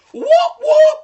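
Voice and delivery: two high, loud whoops; celebratory whoop. Real, not acted. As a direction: whoop like you just won. Celebrating finishing inside the time.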